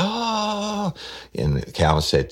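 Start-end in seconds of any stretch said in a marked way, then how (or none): 0.0-0.9 falsetto panicked woman pleading; high mock wail. Detective imitates the suspect pleading innocence, falsetto whine. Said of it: "she was like".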